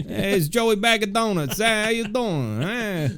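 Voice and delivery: high, hammed-up New York wiseguy; mock New York band member greeting fans; New York accent. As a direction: ham up a Brooklyn wiseguy introducing himself. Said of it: "very deep New York accent".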